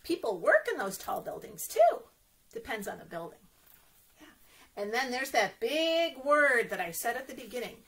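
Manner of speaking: ordinary delivery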